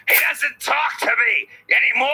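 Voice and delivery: anguished cry. Shown as a demonstration. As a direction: wail the line as written with its exclamation point. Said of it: "So I'm supposed to say".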